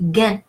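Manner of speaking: "gain" said French-style "gan" with nasal vowel; French "guin", ending in the nose; hard G, nasal ending. Shown as a demonstration. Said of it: "G U I N is pronounced gain".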